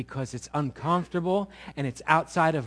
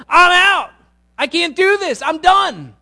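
high, loud, pleading outcry; a loud, high plea to God; desperate cry to God; 8 semitones higher, 8 dB louder than usual. Acted. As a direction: cry out that you're done. Pastor voicing prayer of surrender.

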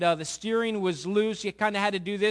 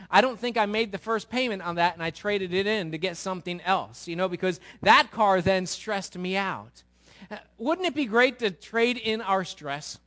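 ordinary talk, nothing marked

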